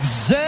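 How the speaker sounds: "ze" said low and punched, z for the; French "ze". Retold or imitated. Comedian mocks French saying the as ze, low punched. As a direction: drop the voice on a dumb "ze". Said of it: "drop d'une coche à chaque".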